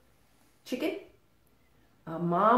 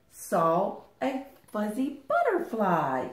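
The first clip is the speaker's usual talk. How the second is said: ordinary delivery, nothing marked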